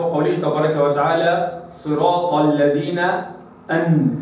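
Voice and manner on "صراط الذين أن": slow recitation, أن with the noon clearly sounded. Shown as a demonstration. Quran teacher showing clear izhar of the noon. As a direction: recite slowly, make the noon in أن plainly heard. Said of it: "هكذا".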